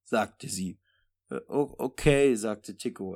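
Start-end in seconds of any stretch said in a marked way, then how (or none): 1.3-2.3 reluctant, strained stammer; pained stammer. Acted. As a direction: force out a reluctant okay. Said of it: "etwas gequält".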